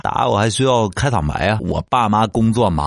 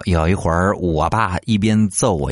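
normal talking voice

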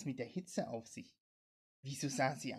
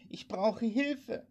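high, loud, drawn-out cry; woman desperately calling over the radio; calling for help; 8 semitones higher, 6 dB louder, much slower than usual. A narrator's reading. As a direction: cry out slowly for help, pitch raised. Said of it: "rief die Frau".

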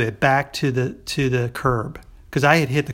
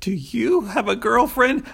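put-on higher, slow, careful asking of "Do you have a girlfriend?"; tentative question; 10 semitones higher, slower than usual. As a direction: ask it gently, as he really might have. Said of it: "it comes across like".

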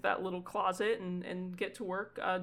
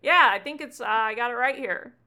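put-on higher, much louder kid voice; a kid fumbling for a card, raised and keen; eager kid; 4 semitones higher, 10 dB louder than usual. Gunther checks he has Ewan's card, loud eager kid voice.

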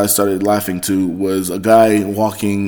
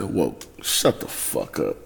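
much lower, softer put-down; muttered self-mock; 10 semitones lower, 12 dB quieter than usual. Scolding himself for saying woke, low and soft. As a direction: grumble it down under the breath.

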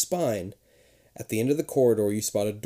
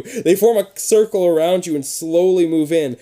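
plain reading voice

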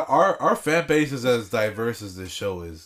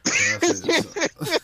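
high voice; slower than usual. Pretend. Repeating own line in put-on voice.

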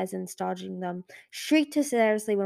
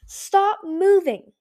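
a huge bellow, much higher and louder, drawn out; bellowing order; 11 semitones higher, 11 dB louder, slower than usual. A narrator's reading. Clay on a boulder bellowing over the chaotic cave, a full-throated roar. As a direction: roar it across a noisy cave. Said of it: "he bellowed".

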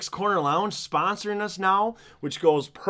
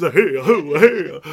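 louder, manic "hey, oh, hey"; Robin Williams impression; 9 dB louder, slower than usual. Podcast host redoing his Robin Williams bit for his co-host, louder and manic. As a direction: burst out with the hey like a hyper comedian. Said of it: "and my Robin Williams".

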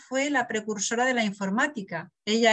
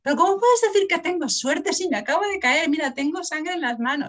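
put-on upset child protesting, pitched up and louder; angry little girl; 6 semitones higher, 4 dB louder than usual. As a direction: voice the daughter's indignant outburst. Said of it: "me mira toda enfadada".

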